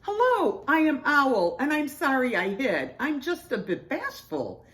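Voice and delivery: high-voiced owl introduction; bashful owl. A narrator's reading. Owl apologises to new friends, bashful.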